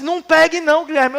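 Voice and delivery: high-voiced aunt pleading with the boy; a worried aunt warning the boy, in a high voice; aunt's warning. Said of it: "e disse".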